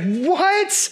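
"What?" thrown up high in shock; emphatic What. Shown as a demonstration. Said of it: "There's some emphasis on this".

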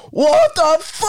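high-pitched mock shock; shocked disbelief. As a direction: squeal it like you just saw the drop. Looking down at the cliff.

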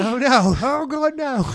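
loud high panicked no; frantic denial. Pretend. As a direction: yell it out, horrified.

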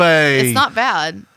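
put-on very slow, loud English-accent line; mock English accent leaning on not. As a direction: drag it out like a British singer. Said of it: "like [name]'s".

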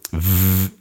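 V held as a voiced buzz; buzzing fricative. Shown as a demonstration. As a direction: sustain the V sound, teeth on lip, vibrating. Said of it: "a fricative".

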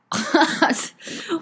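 short high giggle; a quick bright laugh. Real, not acted. Laugh after joke.

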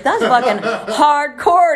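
high, loud, drawn-out disbelief; mock affronted shock. Drunk son to blunt mom, loud drawn-out mock shock. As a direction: stretch it out, loud and high, as if stunned. Imitates son's stunned reply.

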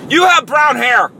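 high, loud, slow mock yell; mock insult. Mocking someone using age as an insult, mock yell. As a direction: hurl it like a silly, outraged accusation. Mocks a pointless insult as an outburst.

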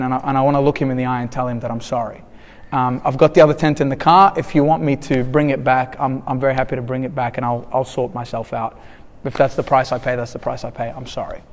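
low, soft, subdued apology; humbly owning up, voice dropping away; retold apology. Retelling his apology to store worker.

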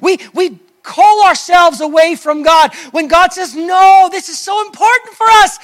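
preacher erupts at full force on No; preaching shout. Acted. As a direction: shout the call to the congregation. Preacher builds to a climax.

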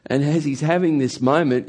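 ordinary delivery

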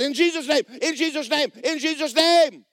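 high, loud chant-like cries; repeated shouted rebuke. Retold or imitated. Man drives the snake back, urgent shouted rebuke.

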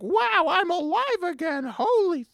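high-pitched, drawn-out cartoon doll voice; revived Nutcracker doll. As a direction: exclaim it as a squeaky toy come to life. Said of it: "and then he's like".

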